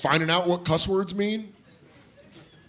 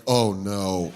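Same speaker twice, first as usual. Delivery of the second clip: dawning dread; 7 semitones lower than usual. Acted. Grown-up's dawning realization.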